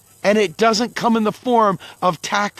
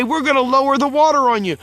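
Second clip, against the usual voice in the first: mock official voice; 4 semitones higher, faster than usual. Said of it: "they say".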